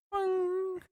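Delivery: high, held boing noise; sustained high-pitched boing sound effect; imitated boing. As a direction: mimic a high electronic boing. Imitating an old logo sound.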